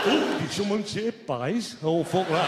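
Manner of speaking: dragged-out mock Northern drawl; wrong-speed Lancastrian. Mimicking Northern woman drawn by pies, slow Lancastrian voice. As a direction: drag it like a slowed record. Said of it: "sounds like you're not playing at the right speed".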